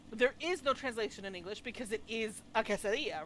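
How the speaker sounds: usual speaking voice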